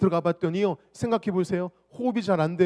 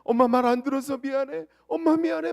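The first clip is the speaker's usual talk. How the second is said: crying apology; 7 semitones higher than usual. Retold or imitated. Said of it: "아들이 막 울면서 이렇게 말합니다".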